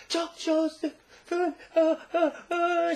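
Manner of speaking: very high, choked, hammed-up gasping; mock panicked stammer. Mother frozen holding the lifesaver, mock panicked stammer. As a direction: stammer the name in put-on helpless panic. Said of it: "she's just shaking".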